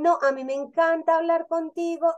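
a friend thanks you for just listening, lightly gushing; delighted quote. Retold or imitated. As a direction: gush your thanks, lightly acted. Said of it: "y dicen".

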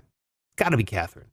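low, falling "KATH-rin" for Catherine. Real, not acted. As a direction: settle on Catherine with a short, weak ending. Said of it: "it's spelled all crazy".

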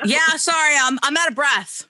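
high, loud sugary brush-off; fake polite excuse. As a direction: pretend to be winded and sweetly decline. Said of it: "I'm like".

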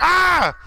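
high, loud mock scream of fright. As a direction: yelp like a startled heel. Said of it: "Jericho's like".

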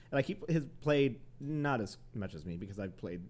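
ordinary delivery

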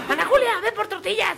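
high voice copying a mother's errand call; child repeating a mother's call, laughing; imitated child parroting mom. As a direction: sing it out like a kid echoing mom. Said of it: "repetía lo que escuchaba".

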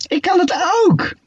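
high, slow cry of joy; crowing with delight; thrilled. A narrator's reading. Shark proudly cries out while swimming.

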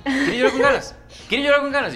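put-on high, loud teasing shout at the child; mock parent yell. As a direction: ham up a fed-up parent hollering. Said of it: "yo se lo digo a Noah siempre".

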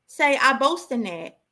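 ordinary talk, nothing marked

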